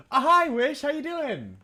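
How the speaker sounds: high, loud cheery hello; excited greeting. Real, not acted. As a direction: squeal a happy welcome. Streamer greets viewer in chat.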